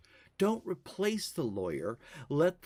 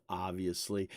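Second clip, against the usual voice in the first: usual speaking voice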